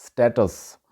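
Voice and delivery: स्टेटस with a short open "a", stat-us; correct pronunciation. Shown as a demonstration. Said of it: "जो सही तलफ़ुज़ है".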